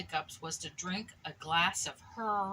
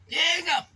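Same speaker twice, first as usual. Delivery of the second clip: high loud hiccup cutting in; 8 semitones higher, 9 dB louder than usual. Acted. Piglet thanking the ducks, interrupted by a hiccup. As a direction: hiccup in the middle of the words.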